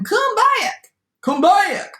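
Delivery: high, loud call after a runaway; calling shout. A narrator's reading. Old rancher calls after the runaway gingerbread, high urgent shout. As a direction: holler high to someone escaping. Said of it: "shouted the little old rancher".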